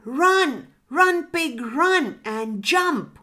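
high, loud urging cheer; calling out. A narrator's reading. Birds urge pig off the mountain, calling out. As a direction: send the runner off with a cry. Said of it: "called out the two birds".

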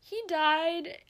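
high, loud, breaking with emotion; forcing the words out through grief; real tearful cry; tearful outburst. Real, not acted. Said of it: "I'm getting so emotional".

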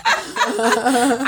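high-pitched plea through laughter; laughing plea. Real, not acted. Laughing plea after teasing a friend.

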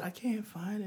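put-on a broken old man almost whispering through tears; crying softly. Crying old man confessing to the singer, hushed and tearful. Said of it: "And he's crying".

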